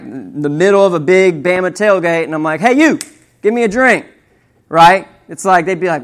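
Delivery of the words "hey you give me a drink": high, louder call-out to strangers; retold brash call. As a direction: hail rivals and demand boldly. Acts out bold demand at tailgate.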